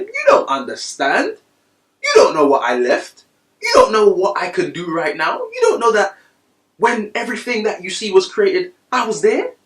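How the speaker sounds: very high, loud cry, nearly breaking; crying out as Jesus. Retold or imitated. Jesus answers his disciples, crying out high and loud. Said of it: "He's saying".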